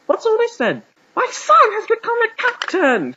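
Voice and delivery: very high, loud exclamation; crying out. A narrator's reading. Father discovering his son is a cacturn, crying out. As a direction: yelp it in astonishment, voice shooting way up. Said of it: "James's father cried".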